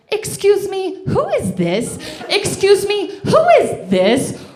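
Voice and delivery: high, loud outraged mom voice; shrieky, hammed-up maternal challenge; indignant mother. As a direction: demand it like an affronted mother, very high. Mother confronting caller, acted.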